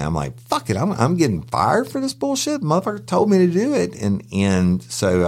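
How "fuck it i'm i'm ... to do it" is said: high-pitched, indignant rant; pissed off. Retold or imitated. Guard fuming at being fired for following orders, pissed off. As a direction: retell it as a fed-up outburst. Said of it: "I was more pissed than anything".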